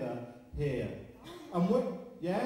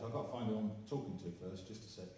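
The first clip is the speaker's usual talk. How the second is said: lower, softer and fast, a hushed aside to himself; imitating Gordon; 7 semitones lower, 7 dB quieter, faster than usual. Medium imitating Gordon tuning in to the audience, lower hushed aside. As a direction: mimic an old medium muttering as he tunes in. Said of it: "And he would go like this".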